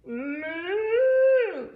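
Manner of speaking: loud, high, rising moo; imitated cow bellow; long cow moo. Cow jumps into the pond.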